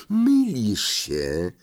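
very slow, stretched words; grave contradiction. Acted. Ron corrects Harry about talking to snakes, grave and very slow. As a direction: draw out each word firmly.

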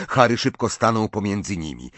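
usual speaking voice

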